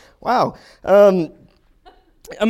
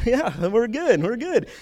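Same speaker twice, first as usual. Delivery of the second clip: slow, high, breezy imitation of the calm goalie; unbothered chill goalie; 7 semitones higher, slower than usual. Shrugging off a slap shot to the face, slow and breezy. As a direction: drawl it out like nothing happened. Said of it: "the most chill guy you've ever met".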